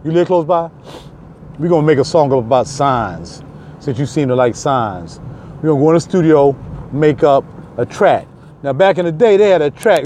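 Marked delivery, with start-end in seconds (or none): none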